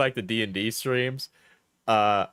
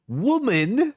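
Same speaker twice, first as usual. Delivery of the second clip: higher-pitched mock British accent; put-on accent; 8 semitones higher, 3 dB louder than usual. Reading breakup texts back, high put-on British accent. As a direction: act the texts out in exaggerated British. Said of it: "when I'm doing the accent".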